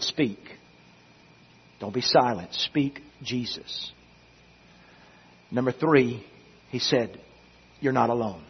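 very soft, low, with long pauses; hushed sermon closing. Real, not acted. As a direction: let each phrase sink into the silence. Hushed closing after long silence.

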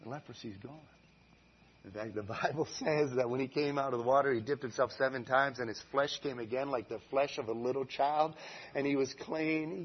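ordinary talk, nothing marked

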